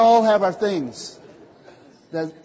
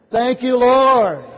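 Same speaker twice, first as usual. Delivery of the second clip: fervent thanks; 6 semitones higher, 9 dB louder, much slower than usual. Real, not acted. Preacher bursts into thanks.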